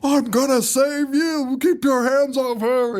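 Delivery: high, drawn-out hero's plea; hammed-up, high-pitched damsel-saving speech; mock heroic cry. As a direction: parody a rescuer calling out. Mocking a hero's lines not said.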